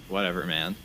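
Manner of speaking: low, bored brush-off; eye-rolling dismissal. Acted. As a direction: roll your eyes as you say it. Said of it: "rolls their eyes and is like".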